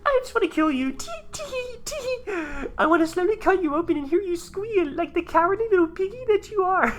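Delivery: loud, very high squeaky voice; giggling maniac. Acted. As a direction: squeal the threat like a gleeful psychotic girl. Acting a giggling killer tank.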